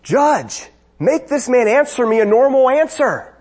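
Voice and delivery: high, exasperated courtroom outburst; upset attorney. Retold or imitated. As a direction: plead loudly and indignantly with the judge, pitch jumping up. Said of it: "getting so upset".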